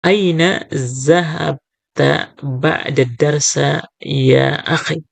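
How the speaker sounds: each word drawn out slowly; slow teacher model reading; slow Arabic reading. Shown as a demonstration. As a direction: read it slowly for the students. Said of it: "Yang keenam".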